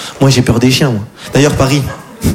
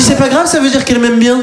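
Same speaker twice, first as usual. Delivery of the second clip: much higher, fond and silly; doting owner; 9 semitones higher than usual. Retold or imitated. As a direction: excuse the dog like a proud mother. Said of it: "Elle me dit". (maîtresse attendrie, voix aiguë)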